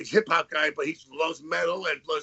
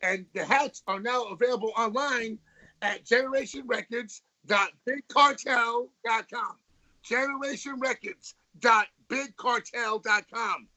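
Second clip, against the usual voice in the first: upbeat merch plug, slightly raised; ad read; 4 semitones higher than usual. Acted. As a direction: sell it like a radio ad. Plugs merch like an ad.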